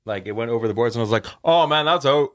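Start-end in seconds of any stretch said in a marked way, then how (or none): 1.4-2.3 louder, higher exaggerated Canadian; hammed-up Canadian hockey player; super Canadian accent. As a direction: exclaim it in a thick Canadian accent. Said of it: "I turned super Canadian".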